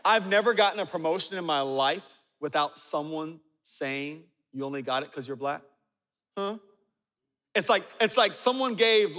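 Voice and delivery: ordinary talk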